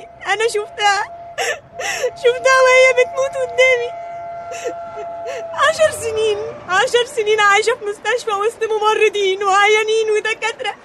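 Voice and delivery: tearful, distraught outpouring; anguished crying. Acted. Girl telling how her mother died before her, anguished crying. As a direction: pour it out through tears.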